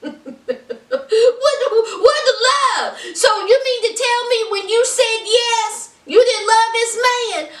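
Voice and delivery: high, incredulous half-laughing protest; worked-up, high-pitched exasperation; amused outrage. Real, not acted. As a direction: challenge her in laughing disbelief. Incredulous, laughing at Natalie's answer.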